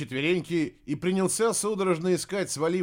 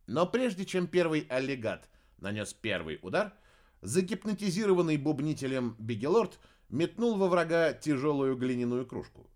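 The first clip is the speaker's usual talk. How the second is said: plain reading voice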